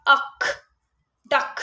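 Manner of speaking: अक then डक, blended sound by sound; sounding out a word. Shown as a demonstration. Teacher showing parents how to sound out डक. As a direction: sound out डक piece by piece like a phonics teacher. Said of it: "जब आप बोलते हैं ड".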